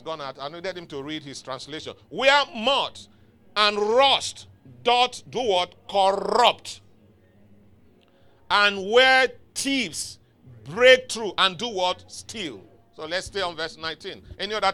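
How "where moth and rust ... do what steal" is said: loud, high, drawn-out scripture proclamation; preaching at full force. Acted. Preacher prompting congregation to finish the verse, forceful declamation. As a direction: hammer each phrase slowly, then drop on the last word.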